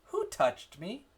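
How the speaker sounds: slow, high question, "touched" stressed, rising on "me". Shown as a demonstration. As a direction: ask it slowly, leaning on "touched". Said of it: "You say".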